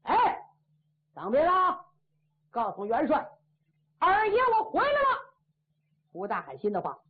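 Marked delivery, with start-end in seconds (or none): 0.0-5.3 high, cocky boasting general; swaggering braggart. Acted. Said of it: "撇着嘴， 舔着肚子".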